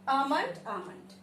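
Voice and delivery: slow "almond" twice, AH-mund; correct variants. Shown as a demonstration. Teacher repeating accepted versions, correctly pronounced. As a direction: leave the L silent in "almond". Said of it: "almond भी कह सकते हैं".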